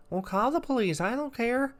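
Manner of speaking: high-pitched put-on voice for a defiant man; mocking homeless man. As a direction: play him high and dismissive. Homeless man's defiant retort, retold.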